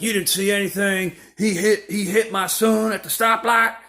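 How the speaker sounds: high, agitated mock yelling. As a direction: imitate an angry parent shouting at a bystander. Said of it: "they start yelling at me like".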